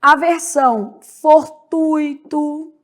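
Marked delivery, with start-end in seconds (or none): 1.0-2.7 very slow fortuito, for-TÚI-to; stress on TUI. Shown as a demonstration. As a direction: stretch TÚI as one syllable in fortuito. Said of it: "a sílaba tônica".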